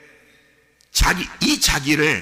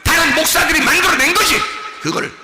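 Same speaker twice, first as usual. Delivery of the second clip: loud, high, hammered repetition; forceful preaching; 11 semitones higher, 7 dB louder than usual. Real, not acted. Preacher naming who made the false self, forceful repeated proclamation. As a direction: hit each accusation with equal force.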